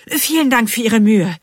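high, loud, hasty thanks; hurried. A narrator's reading. Bean placating the workman, hurried. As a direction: rush in with polite thanks. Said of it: "fügt Bean eilig hinzu".

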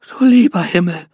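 mother overwhelmed at the sight; awed gasp. A narrator's reading. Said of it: "keuchte sie ergriffen". (ergriffen gekeucht, höher und lauter)

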